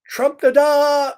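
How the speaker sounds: high, loud, slow trumpet fanfare; mock elephant call, drawn out and loud. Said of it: "the elephant sounds like a trumpet".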